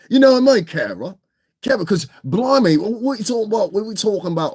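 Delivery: high-pitched mock British voice; playful fake British accent, pitched well up; put-on British accent. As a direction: slip into a hammed-up British "blimey" voice. Slips back into mock British voice.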